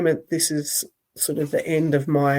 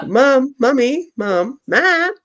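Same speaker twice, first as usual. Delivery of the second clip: put-on child calling mum; 8 semitones higher, 5 dB louder, slower than usual.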